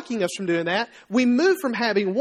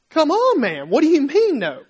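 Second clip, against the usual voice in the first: higher, louder incredulous plea; exasperated protest; 5 semitones higher, 3 dB louder than usual. Acted. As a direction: protest in exasperation at a flat refusal. Acted exasperated reply to refusal.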